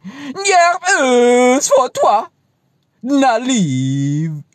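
very high, loud, drawn-out reversed voice; backwards speech. Shown as a demonstration. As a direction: stretch it out high like tape in reverse. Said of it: "Muss man weich machen".

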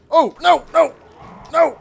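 high, loud panicked cry of no. Real, not acted. Player caught by an enemy, panicked high cry. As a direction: yelp it as the enemy lands a hit.

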